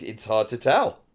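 usual speaking voice

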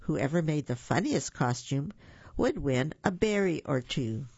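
ordinary delivery, nothing marked

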